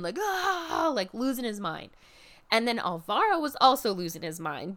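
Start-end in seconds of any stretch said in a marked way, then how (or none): 0.2-0.9 a short high imitated cheer of a crazed fan; mock fan yell. Imitating the fan losing his mind, mock yell. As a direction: yelp it like the screaming fanboy. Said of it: "yelling and cheering".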